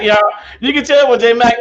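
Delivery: normal talking voice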